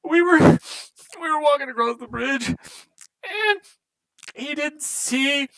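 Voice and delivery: high, tearful dwarf recounting his friend's death; weepy, high-pitched dwarf telling the tale; crying dwarf. Acted. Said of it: "like, crying, he's like".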